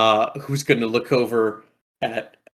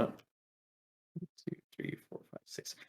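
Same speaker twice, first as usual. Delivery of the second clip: whispered counting; 7 semitones higher, 23 dB quieter, slower than usual. Real, not acted. Counting under breath.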